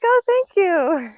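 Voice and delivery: put-on answering a small child, high and slowed; awkward thanks. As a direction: force a polite thank-you. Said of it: "I was like".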